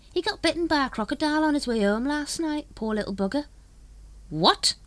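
high, chatty brother passing on gossip; gossipy lodge brother. Acted. Lodge brother tells Grand Master about crocodile, high gossip. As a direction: relish the bad news.

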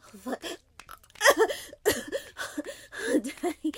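ordinary talk, nothing marked

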